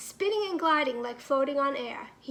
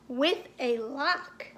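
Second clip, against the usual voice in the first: ordinary delivery, nothing marked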